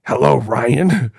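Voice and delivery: a hint of the creepy phone caller; Ghostface voice. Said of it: "So he could be like".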